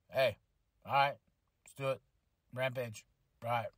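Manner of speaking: low, soft, nonchalant mumble; Orange Cassidy. Retold or imitated. Host imitates wrestler cutting in on the trios, laid-back and low. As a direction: toss it off low and unbothered, with pauses.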